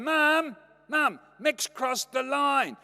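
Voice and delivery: put-on high, loud childish yell; indignant tattling. Brother in car telling on Mick to mum, loud tattling. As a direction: holler for mum, dragging out the complaint.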